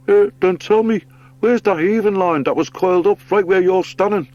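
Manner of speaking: high, loud bird voice, indignant; excited, squawking sea bird. Acted. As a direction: play a worked-up bird pushing an accusation. Story figure accuses the otter.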